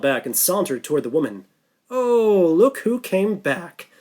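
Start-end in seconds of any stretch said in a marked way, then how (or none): 1.9-3.9 put-on slow, thick Russian accent, rising high on Oh; Sasha's Russian accent. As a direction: drawl it out in a heavy Russian accent. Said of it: "in her thick Russian accent".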